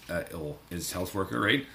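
normal talking voice

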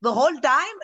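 high-pitched quote of dad; imitating dad. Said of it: "and he's like".

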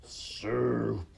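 drawn-out rat voice; rat calling out. A narrator's reading. Another rat smelling soup, calling out. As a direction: call it out long, in a rat's voice. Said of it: "called out another rat".

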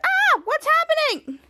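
slow, high alarmed cry; mock alarm. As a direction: voice the panicked onlookers. Kid retelling cartoon scene, voicing characters.

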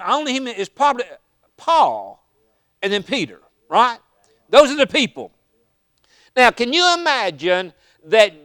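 ordinary talk, nothing marked

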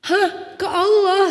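high, loud hammed-up gasp; hammed-up sinetron disbelief; soap opera shock. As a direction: overact like a soap opera wife.

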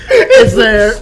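wheezing laugh, high and loud. Real, not acted. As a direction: laugh through the words. Laughing at the spicy sauce.

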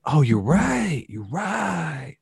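mock surprised agreement; conceding bro. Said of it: "They're like".

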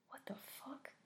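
stunned breathless whisper; horrified mother whispers in shock. Acted. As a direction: gasp it under your breath.

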